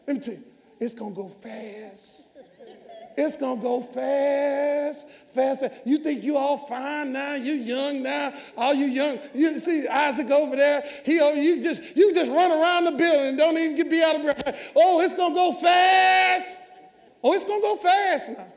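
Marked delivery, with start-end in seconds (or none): 0.1-5.7 hushed warning. Real, not acted. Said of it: "listen to me". 14.7-18.5 drawn-out cry of warning to the youth. Acted. Preacher warns young people, drawn out.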